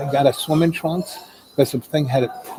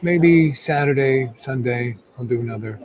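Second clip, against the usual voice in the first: ordinary talk, nothing marked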